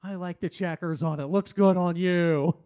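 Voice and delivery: drawn-out sarcastic compliment; slow, mock-admiring, laid on thick. Shown as a demonstration. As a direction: overdo the praise so it sounds insincere. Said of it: "It just sounds like I'm being sarcastic".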